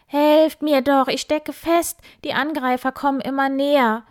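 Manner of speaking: high, loud panicked boar's scream; screaming for help. Acted. Trapped boar Wildi screams to his friends for help. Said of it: "Wildi schrie".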